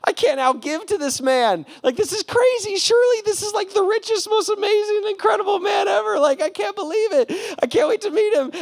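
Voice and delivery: patient gushing in amazement about his rescuer; amazed excitement. Retold or imitated. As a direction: marvel at the generous stranger. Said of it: "And you're like".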